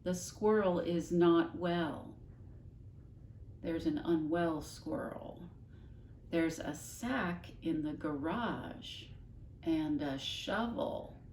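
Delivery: slow, deliberate, each word pointed; mother explaining slowly to the father; speaking slowly. Acted. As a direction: spell it out for him. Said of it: "speaking more slowly now".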